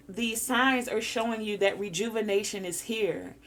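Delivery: ordinary talk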